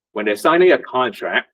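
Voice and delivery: ordinary delivery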